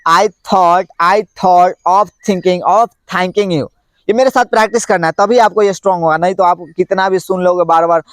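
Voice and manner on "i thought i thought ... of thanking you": very slow, each word apart; slow tongue twister. Shown as a demonstration. Teacher demonstrating a th tongue twister slowly. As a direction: model it slowly. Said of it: "फिर से बोलना है".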